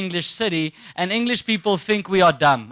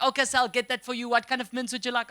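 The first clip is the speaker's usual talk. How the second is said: quick, bright-sounding happy waitress; a cheerful waitress taking the order fast; chirpy waitress; 3 semitones higher, 5 dB quieter, faster than usual. Retold or imitated. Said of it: "She's like".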